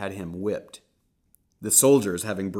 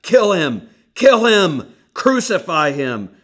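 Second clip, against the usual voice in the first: very loud, much higher, building screams; screaming mob; 11 semitones higher, 17 dB louder, slower than usual. Acted. Crowd screaming back at Pilate, frenzied loud yells. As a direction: scream for his death. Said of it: "They shouted back".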